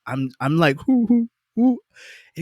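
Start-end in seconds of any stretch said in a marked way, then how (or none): 0.8-1.8 high-pitched "hoo" puffs, imitating stage nerves; mimicked pre-show jitters in high little hoots; nervous hooting breaths. DJ backstage before walking out, imitating high nervous puffs. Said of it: "And I'm just like".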